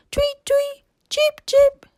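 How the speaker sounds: very high, chirpy birdsong voice; piping bird call, bright and sing-song; bluebird chirping. Acted. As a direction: tweet it like a little bird singing at the window. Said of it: "A bluebird was singing".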